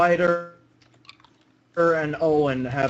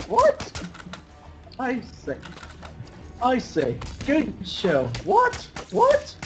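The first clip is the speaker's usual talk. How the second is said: falsetto questions between higher-pitched catchphrases; impression of video copies; 11 semitones higher, slower than usual. Player acting out a YouTuber's repeating copies, high mock voices. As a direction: alternate squeaky "what"s with hearty quoted lines. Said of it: "I'm, I'm doing an impression".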